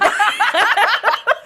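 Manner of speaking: a big real laugh rising up; loud high laugh. Real, not acted. Big laugh at the quote mix-up.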